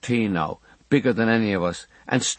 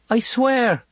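put-on much higher, small child's earnest oath; a frightened boy promising his mother; boy's oath; 13 semitones higher than usual. A narrator's reading. Boy swearing to Mam.

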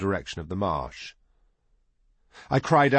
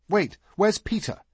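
put-on much higher-pitched boy character; boy's questioning voice; 11 semitones higher than usual. A narrator's reading. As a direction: ask it high and urgent, as a boy. Another boy's urgent question in story.

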